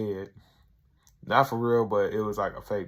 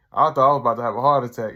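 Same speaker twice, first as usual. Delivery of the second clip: fast, louder mock exclamation; excited retelling; 9 dB louder, much faster than usual. Tyrone bragging to Rob about the party, fast louder mock excitement. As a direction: rush it out, worked up. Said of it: "He's like".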